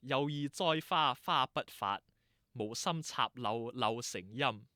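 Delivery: slow, deliberate Cantonese recitation; reciting a saying. Shown as a demonstration. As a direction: recite the old proverb phrase by phrase. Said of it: "it goes like this".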